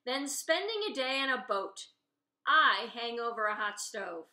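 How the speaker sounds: put-on a cook winning an old argument; loud arguing. Diner cook argues with regulars.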